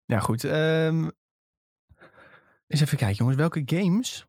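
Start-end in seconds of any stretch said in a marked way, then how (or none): 0.1-1.1 while sipping a drink. Real, not acted. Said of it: "Even een slokje water".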